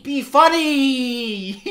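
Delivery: loud, high, drawn-out yell breaking up; exasperated shout. Real, not acted. Viewer pleading with an unfunny film, exasperated yell. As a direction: stretch out the plea in a shout.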